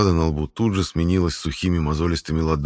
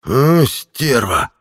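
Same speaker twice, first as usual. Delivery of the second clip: slow, loud wailing curse; an old man howling in pain; 7 semitones higher, 6 dB louder, much slower than usual. Acted. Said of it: "запричитал старик".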